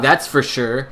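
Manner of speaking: ordinary talk, nothing marked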